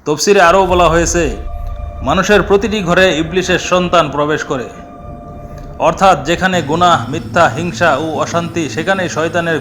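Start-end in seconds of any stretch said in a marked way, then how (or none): none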